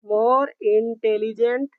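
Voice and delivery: drawn-out teaching delivery; teacher dictating slowly; slow dictation. Shown as a demonstration. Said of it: "intelligent".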